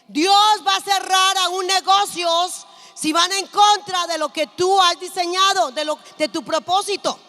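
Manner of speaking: loud, high declaration at full force; forceful preaching. Real, not acted. Preacher tells congregation God will close businesses, shouting with conviction.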